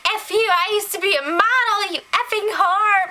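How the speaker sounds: high-pitched, hammed-up outrage; mock angry rant. Retold insult in a housemates' quarrel.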